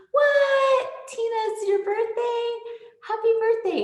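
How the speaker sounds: high-pitched gasping "what", loud then easing; delighted surprise. Real, not acted. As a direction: squeal with surprise into birthday wishes. Surprised by a viewer's birthday.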